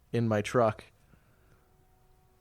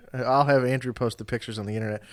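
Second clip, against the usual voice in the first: ordinary delivery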